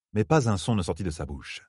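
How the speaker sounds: plain reading voice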